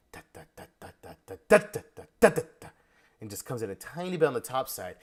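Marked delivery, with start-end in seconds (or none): 0.1-1.4 soft whispered da syllables in rhythm; whispered drum scat. Shown as a demonstration. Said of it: "It's probably gonna be early". 1.5-2.7 higher, louder da syllables; loud drum scat. Shown as a demonstration. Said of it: "It's probably gonna be early".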